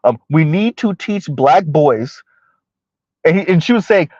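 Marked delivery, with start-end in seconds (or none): 0.3-2.2 slow, evenly weighted words; slow and deliberate. Real, not acted. Host quoting the caller for listeners, slow and deliberate. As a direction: say it slowly and carefully. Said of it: "gonna talk slow so I can be very deliberate".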